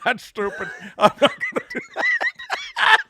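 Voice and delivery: dismissive mock Scottish star; Sean Connery. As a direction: refuse in a Scottish brogue, breaking into laughter. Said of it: "He'd be like".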